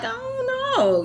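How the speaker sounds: high slow drawn-out I don't know; doubtful. Retold or imitated. Answering her dance teacher, high doubtful drawl. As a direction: stretch it out high, unsure. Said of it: "But I'm like".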